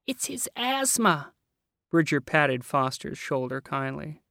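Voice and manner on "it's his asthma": high, very slow trembling fear; quaking. Acted. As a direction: quake it out in fright. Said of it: "Miss Stembecki quaked".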